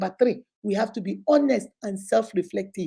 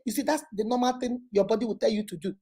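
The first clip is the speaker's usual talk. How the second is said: ordinary talk, nothing marked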